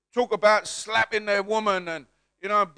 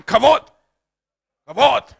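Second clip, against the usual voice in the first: Hebrew accent; 3 semitones higher, 7 dB louder than usual. Shown as a demonstration. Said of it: "say it in a Hebrew accent".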